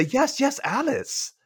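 high, eager imitation; mock-eager yes; eager agreement. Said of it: "he seems to say".

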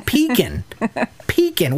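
Pecan as PEE-kin, high-pitched; high, mocking Pecan, second vowel reduced; incredulous mimicked pronunciation. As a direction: echo Pecan like peekin', in high disbelief. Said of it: "I never knew there was a third way".

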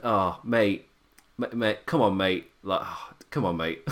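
low, soft muttered protest to the telly; retold exasperated plea. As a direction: retell a weary aside under your breath. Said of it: "out loud just went".

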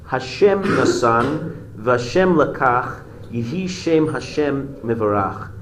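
slow, measured recitation of a verse; slow solemn quote. Retold or imitated. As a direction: let each word weigh. Quoting the Job verse solemnly.

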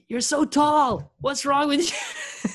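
high, slow mock yell; bully Conan in a hammed-up yell; Bill Burr mocking shout. Bill Burr heckling Conan, hammed-up mocking yell. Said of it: "it's like".

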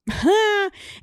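short, high-pitched giggle; high laugh. Real, not acted. Host reading a listener's chemistry joke, high laugh.

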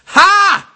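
a loud, high, scornful "Ha!"; mock scoffing laugh. Reading a listener's letter, a loud mocking "Ha!". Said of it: "That's what he said".

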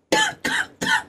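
sharp choking cough; coughing fit. Real, not acted. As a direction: cough out a swallowed bug. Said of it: "I just ate a bug or something".

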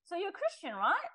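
playful needling with a rising right; teasing provocation. Retold or imitated. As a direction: poke at her a little, half joking. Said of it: "I become a little bit provocative".

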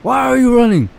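high, loud imitation of an angry African man; harsh African accent. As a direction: yell it hard in a mock African accent. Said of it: "it's just so hard".

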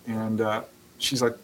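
usual speaking voice

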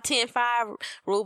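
ordinary talk, nothing marked

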